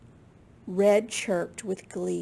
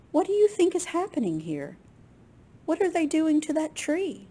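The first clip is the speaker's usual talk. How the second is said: higher, quicker worried questions; alarmed question; 6 semitones higher, faster than usual. Acted. Reader asks the children what is happening. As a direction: ask the kids in mock alarm.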